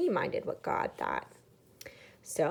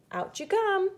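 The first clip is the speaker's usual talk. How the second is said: much higher, bright inviting call; cheerful call; 11 semitones higher, 4 dB louder than usual. Acted. God inviting the family out onto dry land, cheerful call. God calls them off ark.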